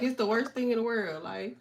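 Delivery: ordinary talk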